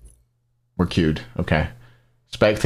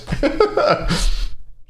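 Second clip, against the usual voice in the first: high breathless laugh. Real, not acted. Laughs after own impression.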